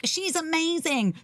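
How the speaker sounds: high, slow gushing praise; doting family. Acted. A doting family raving about their child, gushing. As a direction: gush like an adoring parent, drawn out. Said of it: "the child of a doting family that were like".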